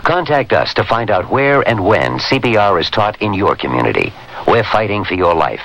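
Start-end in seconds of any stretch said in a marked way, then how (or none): none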